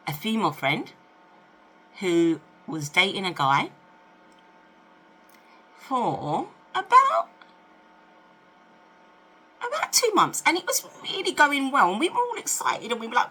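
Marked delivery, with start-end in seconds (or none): none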